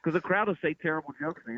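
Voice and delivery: high, pleading exasperation; exasperated plea. Retold or imitated. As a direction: beg them to stop, exasperated. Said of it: "I'm like".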